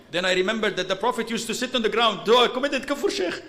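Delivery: rushed, rising mock alarm; mock-panicked questioner. Worried man confessing to the sheikh, mock panic. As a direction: pitch climbing to an alarmed question.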